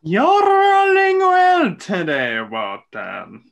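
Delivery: high, slow, suspicious wizard voice. Acted. A wizard accusing Walton of cheating, high and slow. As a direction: draw it out high and wary.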